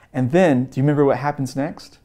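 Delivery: normal talking voice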